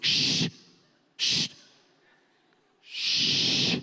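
sharp repeated shh, breathy and insistent; shushing. Retold or imitated. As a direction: hush the others like on a covert mission. Friend shushing during sneaky approach.

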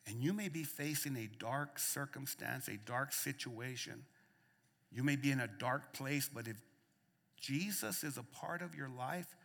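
soft, low, intimate sermon voice; hushed preaching. Real, not acted. Pastor comforting listeners in the dark, hushed. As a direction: bring the room in close and quiet.

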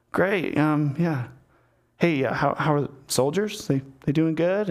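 halting, filler-laden mock chit-chat; awkward small talk. David making conversation with Uriah to hide his guilt, awkwardly. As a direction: fumble for the next question, with gaps. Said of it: "this really awkward small talk".

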